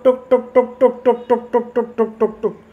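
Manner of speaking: rhythmic mock tick-tick of rising price; playful ticking sound. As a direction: tap out tuk tuk, fading away. Imitates price ticking upward.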